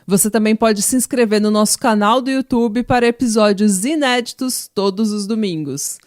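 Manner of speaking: loud, projected outro announcement over music; promo read. Acted. Host urging listeners to support the podcast, loud promo read. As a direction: sell it to the listeners with punch.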